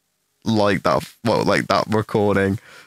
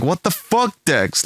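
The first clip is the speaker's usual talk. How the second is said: incredulous outburst; 6 semitones higher than usual. Retold or imitated. Friends react in disbelief.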